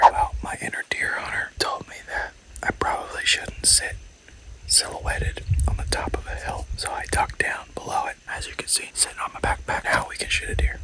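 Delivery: whispering so the deer won't hear; hushed field whisper, unvoiced; hunting whisper. Real, not acted. Hunter whispering while glassing deer.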